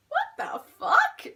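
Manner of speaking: drawn-out high disbelieving what the fuck; shocked reaction. Retold or imitated. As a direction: squeal it out as the shock hits. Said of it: "And I was like".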